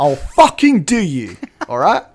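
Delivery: loud, high mock threat; over-the-top aggressive cop shout; crazy aggressive cop. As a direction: bellow a hammed-up police threat. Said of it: "like, crazy aggressive".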